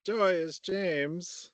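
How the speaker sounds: lightly sung-out joy; joyous. Pretend. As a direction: lift the name with pretend delight. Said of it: "passing the emotion that goes to it".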